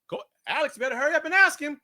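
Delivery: fast, soft, high-pitched urging; high, rushed phone prompt; urging manager. Retold or imitated. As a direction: hurry him along in a small high voice. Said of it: "I hear this".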